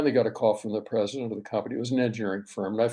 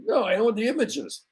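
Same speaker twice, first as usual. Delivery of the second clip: put-on high-pitched New York tough-guy accent, a touch louder; 11 semitones higher, 4 dB louder than usual. Construction boss on the phone claiming the images, in a high New York accent. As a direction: play a brash New York contractor insisting he owns the images, in a high New York accent. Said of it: "He had a wonderful New York accent".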